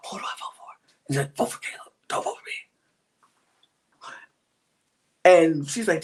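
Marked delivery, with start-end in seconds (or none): 0.1-4.1 whispering. Retold or imitated. Retelling whispered plotting.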